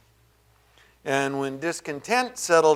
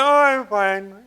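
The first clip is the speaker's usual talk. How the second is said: slow, higher mock breezy denial; fake fine; 6 semitones higher, 3 dB louder, slower than usual. Preacher imitates miserable believer, slow mock breezy. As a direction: drag out a falsely cheerful fine. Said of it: "You know, but".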